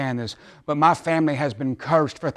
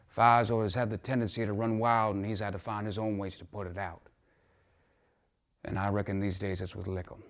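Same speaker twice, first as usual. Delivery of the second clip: low and soft, sinking lower; subdued admission; 4 semitones lower, 8 dB quieter than usual. Acted. Father admitting his son's drinking to Mister Clark, subdued. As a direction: confide it quietly, dropping away at the ends.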